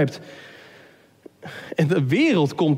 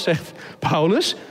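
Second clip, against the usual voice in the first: ordinary talk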